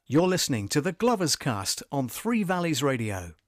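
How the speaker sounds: loud, upbeat station ident; radio announcer. Acted. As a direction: punch it like a radio jingle.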